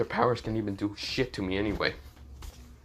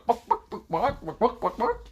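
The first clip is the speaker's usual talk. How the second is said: high, yappy animal noise; silly chicken barking; 10 semitones higher than usual. Acted. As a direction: bark like a cartoon chicken.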